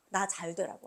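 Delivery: usual speaking voice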